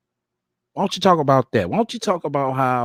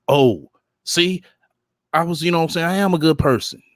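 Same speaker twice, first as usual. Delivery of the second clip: higher, put-on voice for the woman's excuse; mocking her self-justifying; 7 semitones higher than usual. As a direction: mimic her, sweetly insisting she's good. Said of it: "like I said, it helps her, in her mind".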